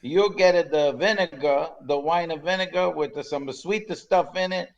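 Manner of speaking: lightly Italian waiter, broken English; Italian accent. Retold or imitated. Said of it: "your Italian accent is excellent".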